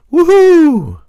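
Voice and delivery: high, loud mock cheer; excited whoop. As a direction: whoop it up for the apocalypse. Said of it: "are excited about the apocalypse".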